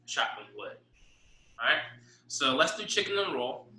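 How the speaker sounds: ordinary talk, nothing marked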